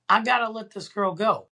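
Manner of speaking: high-pitched talking to himself; retold inner resolve. Thinking to himself about letting her go, high retold resolve. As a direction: raise the pitch for an inner thought.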